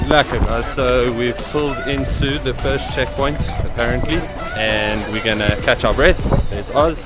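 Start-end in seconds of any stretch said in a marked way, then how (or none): none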